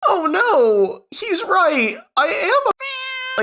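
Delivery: hammed-up whiny little brother giving in; mock whiny cry. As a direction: whine it like a pathetic crybaby. Mocks younger brother caving in.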